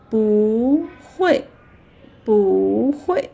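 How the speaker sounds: 不 drawn out upward, 会 falling; rising then falling. Shown as a demonstration. Teacher models natural 不会 for learners, 不 rising. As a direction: glide up on 不, drop on 会. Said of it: "a rising tone".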